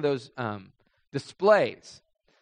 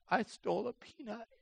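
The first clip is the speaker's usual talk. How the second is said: put-on small, much softer little-kid voice; timid confession; 6 semitones higher, 12 dB quieter than usual. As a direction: barely get the words out to the manager. Confessing to manager as child.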